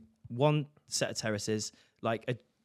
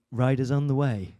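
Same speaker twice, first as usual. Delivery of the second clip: app message voice. Retold or imitated. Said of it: "What do they say?".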